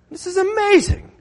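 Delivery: the risen man gasping out his amazement; amazed exclaim. Pretend. Risen man marvels at the crowd, amazed and loud. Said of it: "wish Luke told us what he said".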